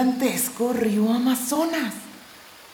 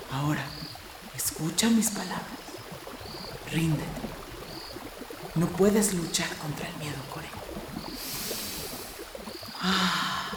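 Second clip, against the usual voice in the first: lower, softer soothing guide; calm guiding voice; 4 semitones lower, 8 dB quieter than usual. Acted. Tayo calming Core in the quicksand, lower and softer.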